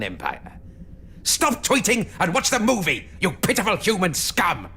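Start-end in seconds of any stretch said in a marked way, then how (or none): 1.2-4.7 loud furious command; barked command. Acted. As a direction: bark the order at them. Sontaran orders the audience.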